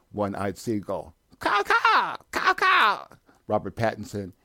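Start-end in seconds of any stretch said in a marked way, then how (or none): 1.4-3.1 very high, shrill squawking caws; shrill screeching bird woman. Acted. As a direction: screech like a seagull. Seagull voiced.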